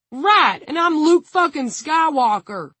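high, loud scoffing shout; sarcastic yell. A narrator's reading. Tig scoffing at the bomb warning, sarcastic shout. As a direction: yell back in mocking disbelief.

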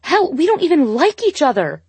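high, loud furious yell. A narrator's reading. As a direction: yell it, pitched high and angry. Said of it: "she yelled".

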